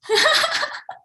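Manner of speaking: high bright burst of laughter; high giggle. Real, not acted. Laughing at accent mix-up.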